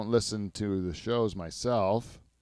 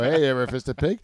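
mock comedian opener; 4 semitones higher, 8 dB louder than usual. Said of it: "I'll just go".

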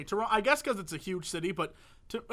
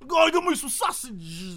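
slow, higher mock Chicago driver; sausage-hungry Chicagoan; 6 semitones higher than usual. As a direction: drawl it out like a hungry local. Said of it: "they're all driving around like".

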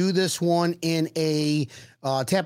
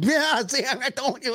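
higher-pitched mock gloating; mock bragging; 7 semitones higher than usual. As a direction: play the smug armchair expert. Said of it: "I was just gonna like".

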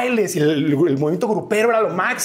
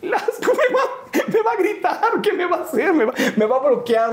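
very high, squeaky mock dread; panicked babble pitched way up, with laughter; comic panic; 12 semitones higher than usual. New host fearing the star will veto him, comic panic. Fears Velasco's anger, comic retelling.

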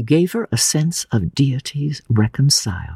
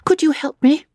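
high, pleading, distressed voice; small high plea; tearful appeal. A narrator's reading. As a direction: ask for help through tears. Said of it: "looked at the sobbing girl".

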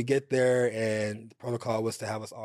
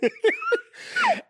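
squealing high laugh; 4 dB louder than usual. Real, not acted. Telling her friend the story, real squealing laugh. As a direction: burst into a shrill, high laugh.

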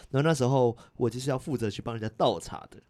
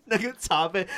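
ordinary talk

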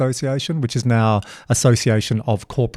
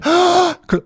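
mock gasp; 3 dB louder than usual. Audience reaction retold.

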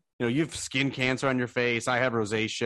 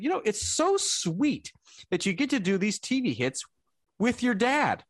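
much higher, doting voice; sweet lady fan; 13 semitones higher than usual. Retold or imitated. Fan thinking Rex is Ryan's dad, much higher voice. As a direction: coo it like a kind older woman. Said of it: "and she said".

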